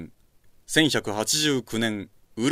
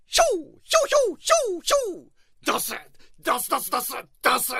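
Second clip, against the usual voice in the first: high, fast fighting shouts; battle yells; faster than usual. Acted. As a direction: swing and yell with every blow. Benkei fighting off enemies.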